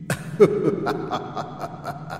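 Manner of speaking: breathy ghostly laugh; evil cackle from the dark; demonic cackle. Acted. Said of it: "tiếng cười ... của con quỷ".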